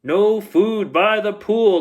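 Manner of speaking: high, loud and slow; lifeguard yell. Acted. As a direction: yell the rule across the pool. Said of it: "the lifeguard yelled".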